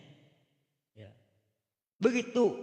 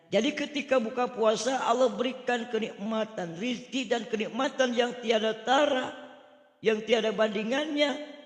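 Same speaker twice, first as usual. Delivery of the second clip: high, loud sermon praise; emphatic preaching; 9 semitones higher, 6 dB louder than usual. Real, not acted. Preacher extolling the blessings of breaking fast, raised emphatic preaching. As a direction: proclaim it with raised voice.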